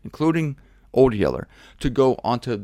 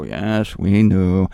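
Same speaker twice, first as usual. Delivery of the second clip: long, drawn-out admission, low and loud; sheepish crowd confessing; 6 semitones lower, 5 dB louder, much slower than usual. Acted. Guilty people answering God at judgment, drawn-out confession. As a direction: admit it slowly as a guilty crowd. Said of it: "they all go".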